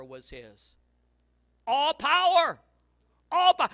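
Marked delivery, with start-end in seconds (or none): 1.7-3.7 booming high repeated shout; shouted refrain. Acted. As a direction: hammer it home with a roar.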